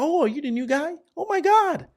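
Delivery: gushing high-pitched office greeter; put-on high chirp of a chatterbox; high-pitched chatty Kiana. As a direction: squeal it like an overfriendly coworker. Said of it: "she's always very high pitch".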